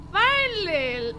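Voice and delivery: slow drawn-out high finally; exasperated driver. Acted. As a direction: let it out with relief and impatience.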